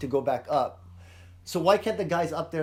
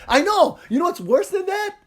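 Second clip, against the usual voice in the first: higher, louder, gleeful mock rant; excited mock rant; 8 semitones higher, 5 dB louder than usual. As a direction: ham up the big reveal to the boys. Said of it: "and he's like".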